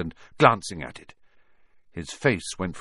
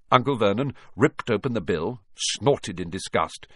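ordinary delivery

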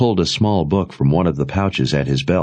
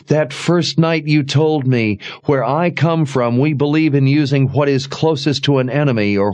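put-on a higher, urgent young woman's voice; lightly voiced young woman; 8 semitones higher than usual. A narrator's reading. Jensen accusing Sebastian, in a higher urgent voice. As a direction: lift the pitch for the accusing girl.

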